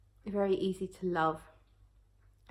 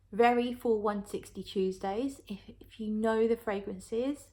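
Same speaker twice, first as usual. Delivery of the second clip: ordinary talk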